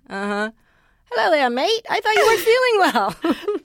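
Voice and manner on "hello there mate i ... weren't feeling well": put-on higher, louder Australian accent, rising higher through the line; mock Australian accent. Australian dad catching her out at the salon, in a higher, louder mock Australian accent. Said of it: "Australian guy?".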